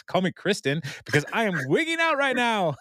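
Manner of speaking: mock panicked outburst climbing high; freaking out. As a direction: imitate a wigged-out man, voice rising to a held high pitch. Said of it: "Craig then freaks out".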